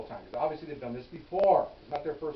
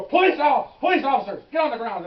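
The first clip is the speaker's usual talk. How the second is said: loud, high shouted police commands; yelling orders at the kids to get down; 12 semitones higher, 14 dB louder than usual. Retold or imitated. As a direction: bark the arrest order at them. Officers announce themselves during arrest.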